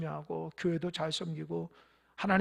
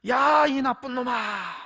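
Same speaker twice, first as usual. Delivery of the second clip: slow, loud shout at the mountain; boy shouting; 4 semitones higher, 8 dB louder, slower than usual. A narrator's reading. Boy yells an insult at the mountain, slow loud shout. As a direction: hurl the insult across the valley, drawn out. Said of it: "산을 향해서 이렇게 소리쳤다는 거예요".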